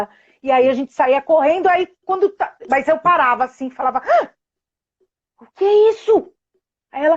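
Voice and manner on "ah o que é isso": mock surprise. Mother feigning surprise to daughter.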